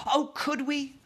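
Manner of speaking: put-on high, excited little girl; eager cry. A narrator's reading. Hungry Sophie answers the Queen's breakfast offer, eager cry. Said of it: "Sophie cried".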